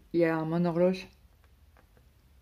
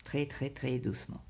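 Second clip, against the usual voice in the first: ordinary talk, nothing marked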